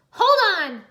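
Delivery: high, loud urgent yell. Acted. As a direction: shout it to hold them steady. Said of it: "Nia yelled".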